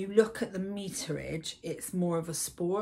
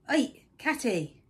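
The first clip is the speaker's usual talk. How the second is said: calling the cat; 6 semitones higher, 4 dB louder, slower than usual. Real, not acted.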